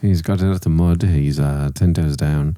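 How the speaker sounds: low-pitched mock British voice; British accent. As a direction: deliver the slang as a British gent. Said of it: "but in a British voice".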